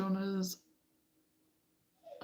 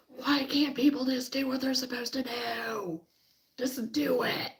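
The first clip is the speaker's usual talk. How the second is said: mock inner rant; 6 semitones higher, 3 dB quieter than usual. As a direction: let the frustration out in a raised voice. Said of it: "I was screaming inside".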